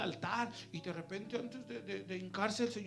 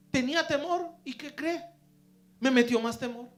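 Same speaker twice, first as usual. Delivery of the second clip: loud high fervent preaching; 7 semitones higher, 15 dB louder than usual. Real, not acted. Preacher cries out loudly to the congregation. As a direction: lift the whole church with it. Emphatic climax of sermon.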